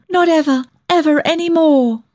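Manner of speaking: high, impassioned vow, close to tears; almost sobbing. A narrator's reading. Daughter swearing her mother won't look tired, high and nearly sobbing. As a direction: promise it high, nearly in sobs. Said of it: "I almost sobbed".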